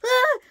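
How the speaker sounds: sudden high loud shriek of fright; a real jump-scare scream; startled scream. Retold or imitated. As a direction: jump and yelp like you've been caught off guard. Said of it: "and I went".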